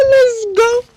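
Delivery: excited shout. Real, not acted. Hyped start of road trip.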